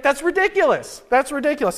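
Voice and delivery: high, laughing "that's ridiculous"; amused mock outrage. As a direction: quote him while still laughing. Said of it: "he's like".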